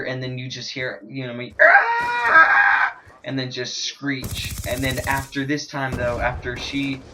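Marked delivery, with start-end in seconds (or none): 1.6-3.0 very high, loud retold shriek; imitated shriek. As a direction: scream in terror like the girl outside. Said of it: "because I heard her".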